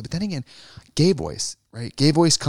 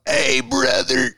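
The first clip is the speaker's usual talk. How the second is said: mock bro greeting; 13 semitones higher, much slower than usual. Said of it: "What about".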